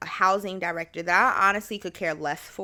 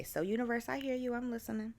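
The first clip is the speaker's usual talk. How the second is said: higher, softer aside to the universe; playful aside; 6 semitones higher, 8 dB quieter, faster than usual. Pretend. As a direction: speak up to the universe softly and high.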